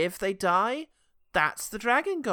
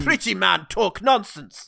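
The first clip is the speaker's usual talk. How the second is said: slow, louder caveman; 2 semitones higher, 7 dB louder, slower than usual. Acted. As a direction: drag out the caveman words.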